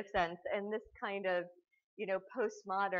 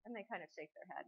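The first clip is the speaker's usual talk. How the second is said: ordinary talk, nothing marked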